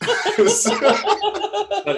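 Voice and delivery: high, slow words through laughter; cracking up mid-sentence; laughing. Real, not acted. Laughing at the bad accent.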